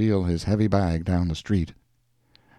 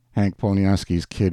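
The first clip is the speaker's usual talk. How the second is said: ordinary delivery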